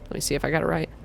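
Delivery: a soft under-the-breath check; muttered aside. Real, not acted. Player checking a place name to themself, muttered aside. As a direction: drop the voice for a self aside. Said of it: "aside before place name".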